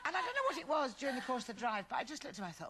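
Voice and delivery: ordinary talk, nothing marked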